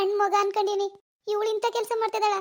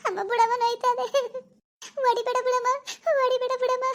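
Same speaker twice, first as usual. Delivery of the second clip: higher, whimpering plea not to be hit; pleading cry; 5 semitones higher than usual. Acted. Daughter pleading with her mother, high and whimpering. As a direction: beg her to stop hitting you.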